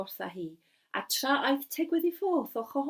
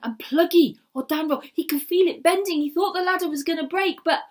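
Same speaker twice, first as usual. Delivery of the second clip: louder, higher, building excitement; dramatic rising telling; 6 semitones higher, 11 dB louder than usual. A narrator's reading. Storyteller acts out the great bird's wind, louder and higher. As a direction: let the storm swell through the words.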